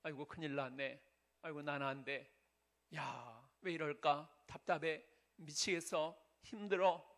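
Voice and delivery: low, subdued self-pitying mumble, building slightly; discouraged inner voice. Acted. Preacher voicing a person's negative thoughts, low and discouraged. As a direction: act out weary negative thoughts under your breath. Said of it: "부정적인 생각이 들 때가 많아요".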